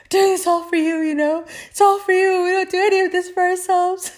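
high, wailing mock guilt trip; dramatic brown parent. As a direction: ham up a self-sacrificing parent. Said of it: "every other brown parent".

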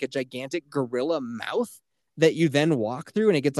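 normal talking voice

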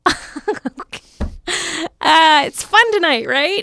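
high, loud, drawn-out fake cheer; mock laughing it off. Shown as a demonstration. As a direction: cover awkwardness with loud forced fun. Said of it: "I will just laugh at whatever they just said".

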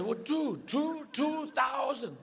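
high voice, number drawn out; cocksure dog. Acted. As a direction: talk the count up like a panicky dog. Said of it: "as the character of the dog".

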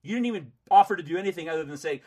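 fast loud angry rant; rapid furious complaint. Real, not acted. Heated argument with collector.

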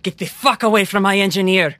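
furious threat. Acted. Character threatens the smoke creature.

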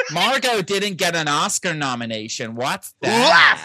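camp LA voice, ending in a shout; camp American accent. Retold or imitated. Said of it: "I could do American in this way".

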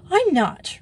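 high, loud denial; sharp retort. A narrator's reading. Joey answering Grisel's taunt, retorting hotly. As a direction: fire back indignantly. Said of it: "retorted Joey".

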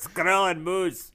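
slow cartoon Russian villain; hammy cartoon spy voice; Boris Badenov. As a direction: drag it out in a mock Russian accent. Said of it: "to Boris Badenov".

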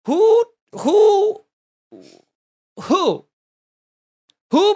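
high, loud, separated hoots of disbelief; mock incredulity. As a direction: demand it in exaggerated disbelief, pausing between words. Mock-incredulous question about adults at Disney.

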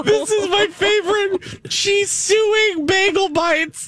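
high-pitched, drawn-out delighted squeal; gleeful squeal. Real, not acted. Host relishing an absurd Bagel Bites lawsuit, gleeful squeal. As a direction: squeal it slowly in giddy disbelief.

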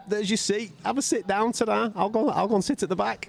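very high, eager-to-please voice; mock deference. Giving Sugar Ray Leonard the seat, with mock deference. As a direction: climb right down in a high, meek squeak. Gives up seat to boxing legend.

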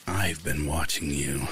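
creepy stranger drawling the words. A narrator's reading. Creepy stranger continues speaking.

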